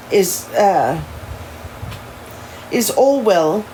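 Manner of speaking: put-on careful, proper English accent; amateur proper-English accent. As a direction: attempt a formal English accent. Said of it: "in his proper English ins-, inspired accent".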